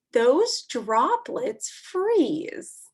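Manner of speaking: slow, drawn-out read-aloud; reading to children. Acted. Teacher reading a snow book to kids, slow and clear. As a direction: read each line slowly to little listeners.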